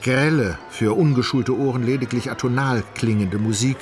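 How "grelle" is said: ordinary delivery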